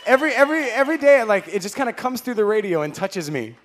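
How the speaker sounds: high, loud, playful gushing; gushing shout. Acted. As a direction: shout a joking crush across the room. Gushing at host's sexy voice.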